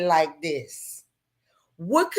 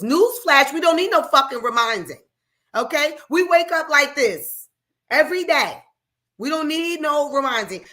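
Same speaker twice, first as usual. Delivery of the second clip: higher and louder, defiant; defiant outburst; 7 semitones higher, 4 dB louder than usual. Real, not acted. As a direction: throw it back in their faces. Defiant message to racists.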